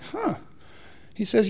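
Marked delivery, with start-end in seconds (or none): 0.0-0.4 a high, soft, startled huh; stunned huh. Retold or imitated. Hearing bad blood results from the doctor, a startled huh. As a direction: caught off guard by bad news, mouth full.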